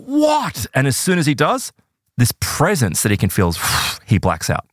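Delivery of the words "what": startled high cry, held back in volume; retold startled scream. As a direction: act out a scream without shouting it. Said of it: "he screams out".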